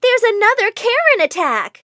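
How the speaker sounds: high-pitched yell of alarm; yelled alert. A narrator's reading. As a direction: call out the alert to everyone. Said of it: "someone yelled".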